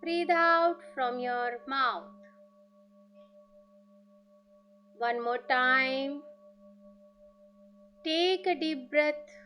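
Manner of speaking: very slow, drawn-out breathing instructions; guided meditation. Acted. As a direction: stretch each cue out slowly with long pauses.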